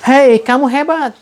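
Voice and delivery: high, loud praise. Retold or imitated. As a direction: shout it proudly. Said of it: "teriakan yang lebih keras".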